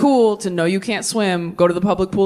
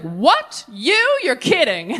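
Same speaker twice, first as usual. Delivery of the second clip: mock disbelief; 7 semitones higher, much slower than usual. Mock shock at friend's news.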